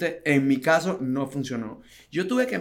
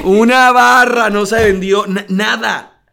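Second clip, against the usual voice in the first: emphatic; 4 semitones higher, 11 dB louder than usual. Real, not acted. Emphatic no sales.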